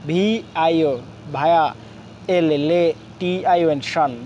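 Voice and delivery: Violation spelled out in chunks with short pauses; broken into syllables. Shown as a demonstration. Teacher showing learners how to pronounce a big word, syllable by syllable. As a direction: sound out each piece of violation after its letters. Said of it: "আমরা ভেঙে ভেঙে".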